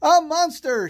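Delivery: high, loud screech. A narrator's reading. Molly seeing an eye in the tree, loud screech. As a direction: shriek it in pure shock. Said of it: "she screeched".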